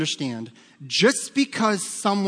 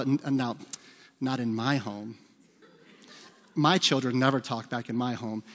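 mock denial; 5 semitones lower, 4 dB quieter than usual. Mocking parents who deny fighting.

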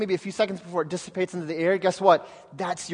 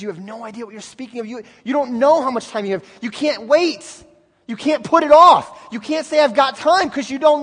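louder and higher, rising to a shout; urgent rebuke; 4 semitones higher, 6 dB louder than usual. Acted. Jesus warning his disciples they cannot wait, rising urgent shout. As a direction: press them hard, no waiting. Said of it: "Jesus says".

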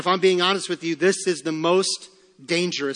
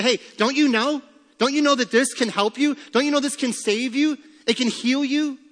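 higher, insistent questions, pressing hard; urgent pleading; 7 semitones higher than usual. Pretend. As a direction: plead with him to take the medicine. Visitor urging sick man take medicine.

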